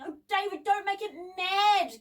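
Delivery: high, gasped plea; worried, high-pitched warning; anxious gasp. A narrator's reading. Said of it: "gasped Debbie".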